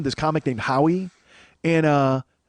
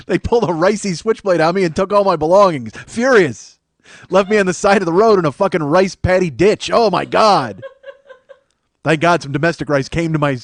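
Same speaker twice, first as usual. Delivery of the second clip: mock outrage; 3 semitones higher, 6 dB louder than usual. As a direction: rant as a hammed-up mugging victim. Mock outrage at rice gang mugging.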